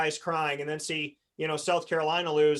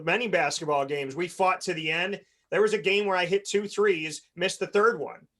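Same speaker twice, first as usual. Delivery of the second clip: usual speaking voice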